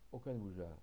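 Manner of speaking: plain reading voice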